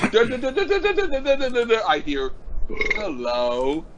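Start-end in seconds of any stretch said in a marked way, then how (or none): 0.1-1.8 high, rapid jangling "da da da"; imitated ringtone. Mocking an ordinary ringtone, high imitated ringing. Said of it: "Instead of hearing". 3.0-3.9 low, soothing phone greeting; soothing recorded greeting. Retold or imitated. Hearing own voice as a ringtone, soothing greeting. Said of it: "that's actually kinda soothing".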